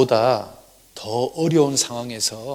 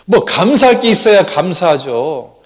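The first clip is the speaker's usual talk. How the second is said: acted loud, high-pitched grumbling; grumbling complaint; 4 semitones higher, 7 dB louder than usual. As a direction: whine at God like a fed-up wife. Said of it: "하나님께 투정을 했답니다".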